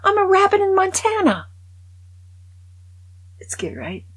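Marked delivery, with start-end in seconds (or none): 0.0-1.5 higher and louder, punching the punchline; punchline shout. Retold or imitated. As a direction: deliver the reveal big and bright. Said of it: "He goes".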